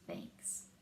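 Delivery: breathless whisper of thanks. Acted. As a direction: whisper it, touched and tender. Friends hug bear, touching moment.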